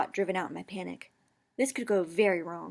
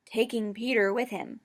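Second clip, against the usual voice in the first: ordinary delivery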